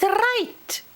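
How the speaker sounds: high-pitched, scolding fairy voice; high, prim story-character voice; fussy little fairy. Acted. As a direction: lecture in a high voice, fussing about getting it right. Voices fussy careful fairy Pish.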